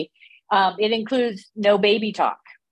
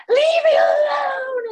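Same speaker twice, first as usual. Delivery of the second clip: shrieked high and loud, dragged out; mock high-pitched screaming fit; wild scream; 4 dB louder, slower than usual. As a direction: scream it like a panicking kid. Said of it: "and they're screaming wildly".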